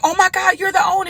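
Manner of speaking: high excited squeal of recognition; excited gush. Retold or imitated. Meeting the restaurant owner, high excited gush. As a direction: gush like you just met a celebrity.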